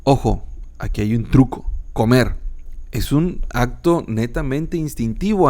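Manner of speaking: ordinary delivery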